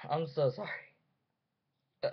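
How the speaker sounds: ordinary delivery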